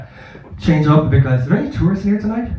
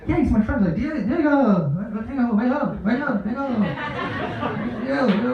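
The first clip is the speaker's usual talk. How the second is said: higher, softer pretend phone chat; fake phone call; 6 semitones higher, 6 dB quieter than usual. Pretending to call his friend, higher and soft. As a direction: mime a fake call, softly.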